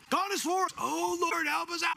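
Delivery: high, loud, frantic pleading to God; mock panicked prayer. Preacher mimicking panicked believers crying to God, high frantic pleading. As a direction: play the anxious believers begging the Lord for rescue.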